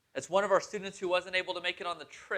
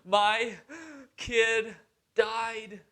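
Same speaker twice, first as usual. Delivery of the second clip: imitating tearful student; 4 semitones higher, much slower than usual. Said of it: "followed with more tears".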